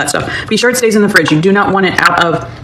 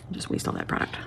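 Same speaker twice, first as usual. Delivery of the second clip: whispering; 17 dB quieter than usual. Real, not acted. Quiet aside while working.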